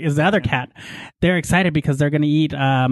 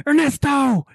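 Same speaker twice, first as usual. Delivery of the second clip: imitating Leighton; 9 semitones higher, slower than usual. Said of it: "'cause that's how he talks".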